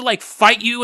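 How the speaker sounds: ordinary talk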